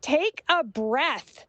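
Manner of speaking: pointedly slow retold outburst; retold werewolf snap. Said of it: "I responded very werewolf".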